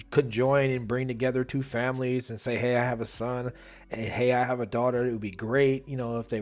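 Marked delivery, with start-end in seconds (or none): none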